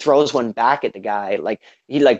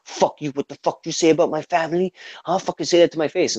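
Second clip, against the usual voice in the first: mock-angry challenge, pitched up without shouting; retold pissed off; 6 semitones higher than usual. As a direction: imitate an outraged guy squaring up. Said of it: "gets really pissed off".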